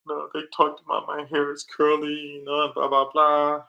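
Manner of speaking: put-on high, childlike complaining voice; son's voice. Father imitating his son complaining about school. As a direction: imitate the young son whining. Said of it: "He was like".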